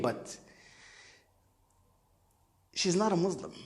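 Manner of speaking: very soft, halting, low admission; nervous. Retold or imitated. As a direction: hedge quietly with long hesitations. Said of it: "Then I start sound like we nervous".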